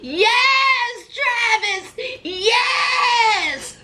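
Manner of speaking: high excited cheer; cheering shout. Real, not acted. Cheering Travis on against Jason, high excited shout. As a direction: whoop for Travis.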